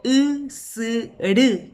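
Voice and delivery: "usuru" lento, en tres sílabas marcadas; silabeado. Shown as a demonstration. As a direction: separa cada sílaba de "usuru". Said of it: "la silabación es sencillísima". ("usuru" pausado, como dictado)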